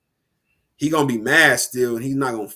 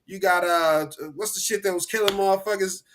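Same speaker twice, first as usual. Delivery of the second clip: higher, hammed-up huckster pitch; snake oil salesman; 6 semitones higher than usual. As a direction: pitch a miracle cure to suckers.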